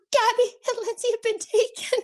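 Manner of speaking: high, squeaky stage-whispered alarm; panicked whisper. Retold or imitated. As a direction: re-enact breaking terrible news in a panic. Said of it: "And I'm whispering".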